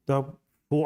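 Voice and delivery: usual speaking voice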